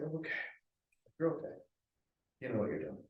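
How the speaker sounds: self-soothing. Retold or imitated. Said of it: "I was like".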